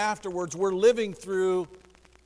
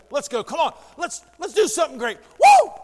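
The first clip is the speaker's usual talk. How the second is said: rising, louder rallying call ending in a whoop; hype shout; 6 semitones higher, 10 dB louder than usual. Real, not acted. Preacher rallying his church, loud excited shout. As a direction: fire the room up and yell woo. Pastor firing up the congregation.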